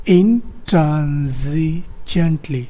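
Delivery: "intransigently" drawn out very slowly, syllable by syllable; careful pronunciation demo. Shown as a demonstration. Teacher shows students the correct pronunciation, "intransigently" slow and syllabified. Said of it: "This word is pronounced".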